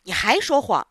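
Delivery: loud, stern accusation; angry boss. Acted. Angry theatre boss to lying Pinocchio, loud and stern. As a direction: bark it at the liar. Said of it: "老板生气了".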